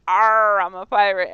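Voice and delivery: loud hammed-up pirate growl. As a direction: bellow it like a cartoon pirate. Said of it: "and then I'm like".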